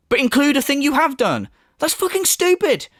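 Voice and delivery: high-pitched, incredulous rant; exasperated disbelief. Real, not acted. Critic ridicules a list of things not done, exasperated. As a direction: pitch climbing in outrage. Exasperated at self-defeating excuse.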